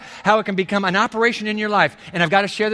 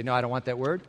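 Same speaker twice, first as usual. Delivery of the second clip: hushed, mock-reluctant; mock refusal; 8 semitones lower, 8 dB quieter, faster than usual. Preacher mimics a listener who refuses God's word, hushed mock refusal. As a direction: softly play the unwilling listener. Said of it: "I suppose you could say".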